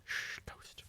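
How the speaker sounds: very soft, voiceless hush; playful whisper. Pretend. Player pretending he was never here, playful whisper. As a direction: whisper it like a secret.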